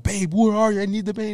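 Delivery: high-pitched pained begging; hammed-up cry for relief; retold desperate plea. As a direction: plead urgently, acting out agony.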